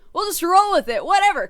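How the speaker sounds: a loud, high give-up; loud, high resignation. Real, not acted. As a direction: toss it away at full voice. Repeats, louder still.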